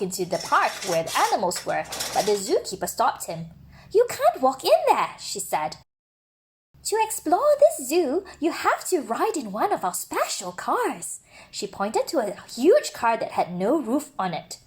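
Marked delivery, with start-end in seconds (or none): 3.9-5.2 higher, brighter-pitched zookeeper stopping him firmly; higher zookeeper voice setting a rule. Acted. 6.8-11.2 higher, brighter-pitched zookeeper stopping him firmly; higher zookeeper voice setting a rule. Acted.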